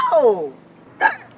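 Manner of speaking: high, loud wail of a hurt mother; loud mock wail, voice breaking; mock crying outburst. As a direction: cry it out in a hammed-up lament. Mother cries out upset.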